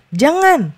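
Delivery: jangan said high and forceful, falling hard; firm warning jangan; emphatic prohibition. Shown as a demonstration. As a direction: stress the word jangan sharply as a warning. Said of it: "biasanya lebih ada penekanan".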